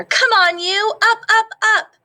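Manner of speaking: high, loud shouting at the leaves; bossy yell. Acted. Capybara bossily yelling at fallen leaves, high and loud. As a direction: holler to wake up the leaves.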